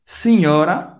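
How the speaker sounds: normal talking voice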